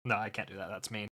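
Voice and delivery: hushed giggle. Real, not acted. Laughing, declines the mean joke.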